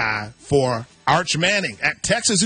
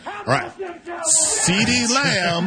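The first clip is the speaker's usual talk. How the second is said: hype call; 7 semitones higher, much slower than usual. Acted. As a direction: belt it out like an announcer. Host hyping the next pick.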